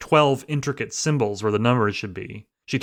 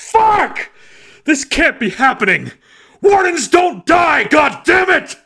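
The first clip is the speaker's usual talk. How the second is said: high, loud, raging despair; furious shout; 7 dB louder than usual. A narrator's reading. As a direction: yell and curse in grief. Agent raging in the car.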